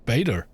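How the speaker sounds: BETA with an AY vowel and a D, "BAY-der"; American pronunciation. Shown as a demonstration. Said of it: "Americans say".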